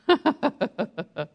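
short run of laughter; real chuckle. Real, not acted. Preacher after reading "all" to the congregation, real chuckle. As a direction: let out a quick laugh.

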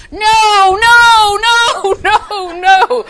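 high, loud repeated no's; mock frantic warning. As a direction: shout each no like waving a red flag. Holy Ghost waving red flag.

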